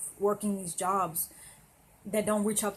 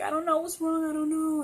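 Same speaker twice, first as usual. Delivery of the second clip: much higher, tearful voice; crying; 9 semitones higher, 5 dB louder than usual. Retold or imitated. Retelling crying at work, high tearful pleading. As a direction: choke the words out high like she's sobbing. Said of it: "And I was crying and everything".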